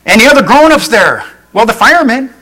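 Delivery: high, loud disbelief; caller freaking out. Acted. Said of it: "the guy's freaking out".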